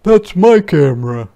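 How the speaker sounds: put-on high, loud indignant kid; a boy claiming what's his. Martin loudly claims the camera is his. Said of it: "Martin said".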